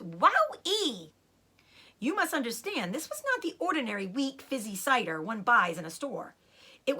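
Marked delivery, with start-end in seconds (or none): 0.0-1.1 high, loud squeal of delight; thrilled young fox after a gulp; delighted wow-ee. Acted. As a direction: let it ride up in glee. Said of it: "he gasped".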